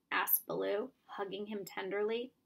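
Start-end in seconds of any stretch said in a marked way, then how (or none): none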